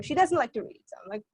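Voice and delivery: ordinary delivery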